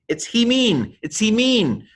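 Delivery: high-pitched protesting modern Greek; mock upset objector. Modern Greek person correcting the teacher, high mock-upset protest. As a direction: squeal the correction in mock indignation. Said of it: "would get upset with me".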